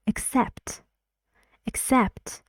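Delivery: "Accept" with a weak first vowel and high, stressed "cept"; reduced first vowel. Shown as a demonstration. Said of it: "And this is like, uh".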